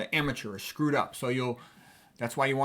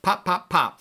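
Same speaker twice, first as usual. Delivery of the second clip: pop, pop, pop as three punched syllables; three stressed beats; 4 semitones higher, 5 dB louder, slower than usual. Shown as a demonstration. Teacher showing the rhythm, pop, pop, pop evenly stressed. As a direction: punch out each pop separately. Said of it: "three stressed syllables in a row".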